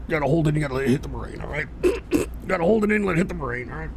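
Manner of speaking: strained soft talk on held breath; holding in smoke. Real, not acted. Host smoking on air, talking on held breath. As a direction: talk without letting the smoke out.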